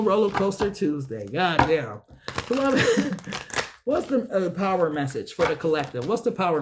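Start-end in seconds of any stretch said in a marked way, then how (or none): 2.9-3.8 quick laugh to himself; short laugh. Real, not acted. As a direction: laugh it off, briefly. Laughs after cursing mid-reading.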